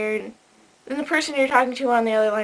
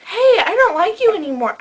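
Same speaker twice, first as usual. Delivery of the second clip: lightly voiced boyfriend, higher and louder; mock boyfriend; 9 semitones higher, 7 dB louder than usual. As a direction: imitate a boyfriend blurting out a breakup. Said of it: "When your boyfriend goes".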